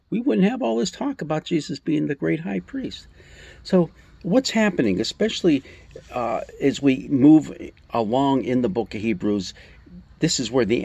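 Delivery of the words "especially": ordinary delivery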